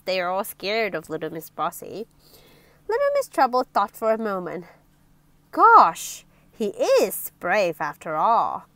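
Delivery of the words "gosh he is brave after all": loud gasped opening, dropping lower; exclaim, then settle into mild awe; awed. Acted. Miss Trouble realising he is brave, awed. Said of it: "she said in awe".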